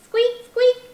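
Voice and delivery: high, loud little mouse squeak; squeaky mouse. Acted. Storyteller voicing Mouse for the children, high squeak. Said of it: "said Mouse".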